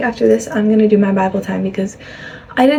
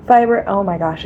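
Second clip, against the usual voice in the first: ordinary talk, nothing marked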